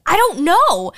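high, loud puzzled voice; dad's clueless reply. Retold or imitated. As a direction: blurt it out high and bewildered. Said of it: "And my dad's like".

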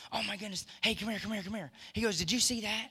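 excited God. Retold or imitated. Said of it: "he's all excited about it".

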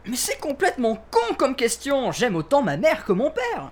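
high-pitched indignant protest; touchy outburst. Acted. Suspect stung by a question about his parents, indignant. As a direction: snap back, voice pitched right up. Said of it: "je viens de toucher un point sensible".

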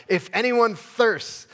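high and loud, slowly called; crying out. Retold or imitated. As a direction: proclaim it like Jesus at the feast. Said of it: "Jesus stood up and cried out".

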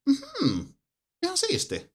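very high-pitched, squeaky hum of approval; imitated reaction. Player reacting to the first choice, high put-on voice. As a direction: put on a tiny high voice, mildly impressed. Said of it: "se oli ensimmäinen semmonen".

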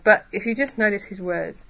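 ordinary talk

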